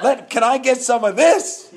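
mock-eager buyer under the bridge; eager request. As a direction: pitch it up like a keen customer. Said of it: "And I was like".

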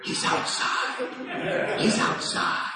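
hushed whisper imitating kids; urgent stage whisper; panicked kids' whisper. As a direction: whisper a secret warning, acted out. Kids whispering behind the door.

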